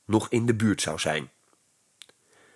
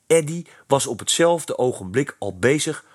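ordinary delivery, nothing marked

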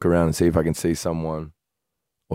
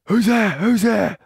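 high panicked yell, much higher; screaming; 14 semitones higher, slower than usual. Retold or imitated. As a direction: shout into the dark, scared. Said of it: "Like, screaming".

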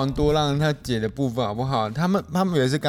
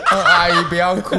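louder, jolted, laughing "阿姨不要哭"; startled awake; 4 semitones higher, 6 dB louder than usual. Retold or imitated. Son-in-law woken mid-call blurting comfort to mother-in-law, laughing. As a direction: blurt it as if just woken, giggling. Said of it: "又醒来说".

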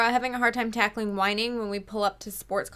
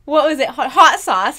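usual speaking voice

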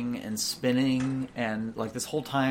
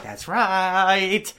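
put-on proud old man; 6 semitones higher, 6 dB louder than usual.

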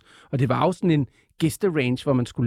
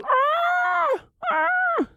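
very high, shrill flying-reptile cries; two long, piercing imitated dinosaur screams; Pteranodon screech. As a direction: screech like a pterodactyl in flight. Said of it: "som siger sådan".

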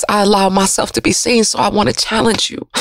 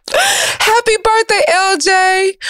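much higher, slow, sing-song happy birthday; birthday greeting; 10 semitones higher, much slower than usual. Real, not acted. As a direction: call out the wish with delight. Aunt greeting nephew on birthday.

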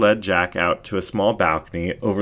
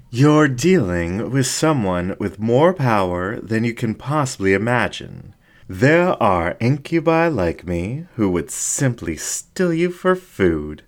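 higher, slow, silky menacing fox voice; seductive incubus ringmaster; 5 semitones higher than usual. Acted. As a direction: purr the threat slowly from above.

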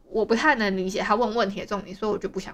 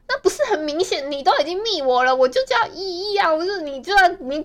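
exasperated; 7 semitones higher than usual. Real, not acted. Exasperated rant about stranger.

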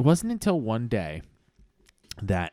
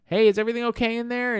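teacher calling; 12 semitones higher, 5 dB louder than usual. Acted. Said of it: "She's like".

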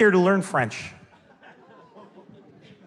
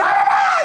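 very high, loud mock babble; teacher's babbled outburst; 5 dB louder than usual. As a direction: shriek rapid nonsense syllables as the angry teacher.

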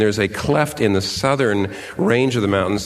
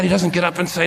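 normal talking voice

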